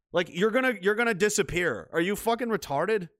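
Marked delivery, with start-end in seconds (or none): none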